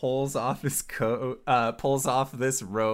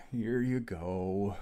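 slow, softer, sighing surrender; deep resigned sigh; 3 semitones lower, 6 dB quieter, slower than usual. Acted. Underling giving up his coat to a newcomer, deep sighing resignation. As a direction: hand it over with a heavy sigh. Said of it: "with a very deep sigh".

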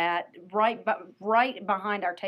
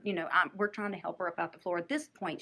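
ordinary talk, nothing marked

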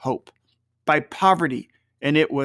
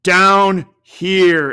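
loud, urgent otherworldly call; ghost's exclamation; 2 semitones higher, 6 dB louder, much slower than usual. A narrator's reading. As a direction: cry out to make him look down. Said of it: "exclaimed the ghost".

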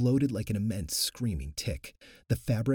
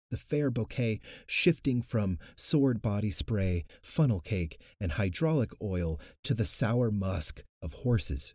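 plain reading voice

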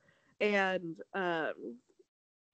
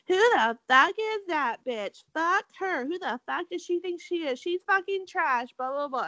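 mock catty mean girl; 8 semitones higher than usual. Acting out catty contestants.

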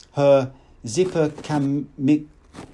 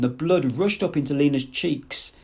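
usual speaking voice

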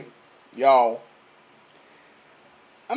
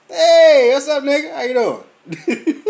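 high, loud hey, easing off; loud greeting; 9 semitones higher, 8 dB louder, slower than usual. Retold or imitated. Coworker walking up to greet him, loud hello.

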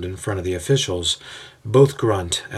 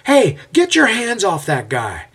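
much higher, loud protest in another voice; yelling; 11 semitones higher, 5 dB louder than usual. A narrator's reading. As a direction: shout to stop them. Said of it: "Aeneas yells out".

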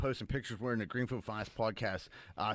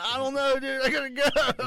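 high-pitched, louder brush-off; flustered excuse; 12 semitones higher, 6 dB louder than usual. Retold or imitated. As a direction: make a high-voiced escape excuse. Retelling his awkward excuse to get away.

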